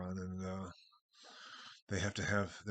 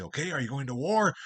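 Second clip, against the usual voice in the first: impatient hobbits; 5 semitones higher, 9 dB louder than usual. Retold or imitated. Impatient hobbits question Treebeard.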